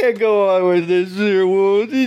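high, slow, weepy voice; hammed-up sobbing; mock crying. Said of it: "can't hold myself back from crying".

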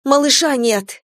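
understated shout, pitched well up; alarmed cry. A narrator's reading. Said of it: "крикнула я".

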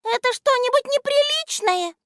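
high, thin, timid little voice; тоненький робкий голосок. A narrator's reading. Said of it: "своим тоненьким робким голоском". (тоненьким робким голоском)

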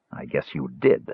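understated stern big man; severe. Acted. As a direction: clamp down on him. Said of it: "Caramon said severely".

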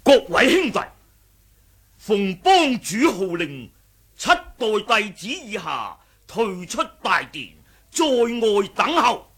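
ringing herald's call; calling out orders. Acted. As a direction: announce the chief's command to the crowd. Relaying chief's order to disciples.

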